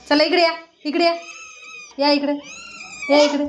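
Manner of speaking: repeated come-here call to kittens. Real, not acted. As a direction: coax the kittens over from across the room.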